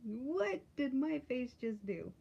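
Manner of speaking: high, loud, drawn-out startled reaction; exaggerated slow, swooping disbelief; mock shock. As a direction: act comically startled at yourself. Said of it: "And it's like".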